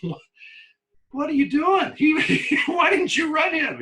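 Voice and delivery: high, loud shouting disbelief; friends' mock shout. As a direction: yell it up high in mock outrage. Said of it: "They said".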